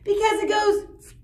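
loud high shouted monster noises; yelling. Acted. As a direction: yell the sounds out wildly. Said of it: "Pig yelled".

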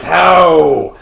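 loud, hammed-up indignant gasp; dramatic outrage. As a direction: overplay the offended fury. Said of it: "he's so, like, angry and dramatic".

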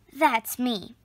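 ordinary delivery

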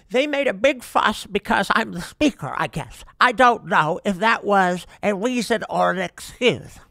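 hammed-up impersonation of a speaker quoting herself; Nancy Pelosi. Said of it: "doing my impersonation of Nancy Pelosi".

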